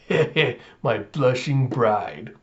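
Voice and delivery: slow, leering thug impression; gangster villain. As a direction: play a creepy gangster with a slow chuckle. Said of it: "this gangster-looking guy".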